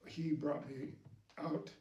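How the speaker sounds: usual speaking voice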